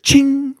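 high ringing bullet ricochet, done by mouth; imitated ricochet ching. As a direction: mimic a bullet pinging off the well. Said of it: "and they hear it".

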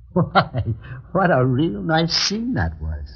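high, slow sarcastic drawl; mocking sneer. Gunman mocking the couple's reconciliation, sarcastic and slow. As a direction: savor the sarcasm.

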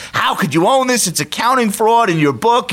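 pitched high, heated mock rant; high-pitched exasperated ranting; retold rant. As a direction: replay the indignant phone rant, mostly in fun. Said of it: "on a speakerphone just ranting".

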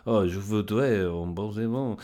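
lightly hammed-up feminine French accent; mock an effeminate Frenchman's accent. Showing how Frenchmen talk, in a feminine French accent. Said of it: "it's quite a feminine way of talking".

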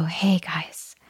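put-on soft, breathy whisper to the kids; hushed, playful whisper. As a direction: lean in and whisper like a secret game. Whispering a game to her kids.

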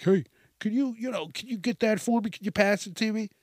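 pushy interrupting friend. Retold or imitated. Oblivious friend interrupts laughter.

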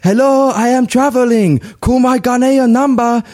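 put-on high, slow French-accented voicemail greeting; Marcel Desailly. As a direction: imitate a French-accented voicemail, pitched well up. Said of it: "where I did the Desailly accent".

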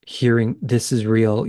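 usual speaking voice

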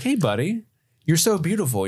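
smitten friend. Retold or imitated. Locke greeting the smoke monster, high fond voice. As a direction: coo at it like a beloved pal.

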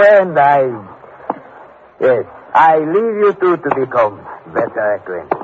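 usual speaking voice

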